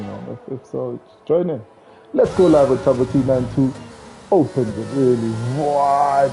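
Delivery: ordinary talk, nothing marked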